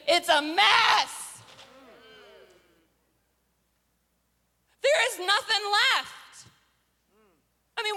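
rapid-fire list building to a high wail; mounting exasperation, stretched loud on "mess"; acted meltdown. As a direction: spiral into hammed-up despair. Acts a man spiralling after total failure.

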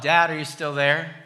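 put-on a scared boy calling from bed. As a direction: call for Dad into the dark. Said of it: "So I call out".

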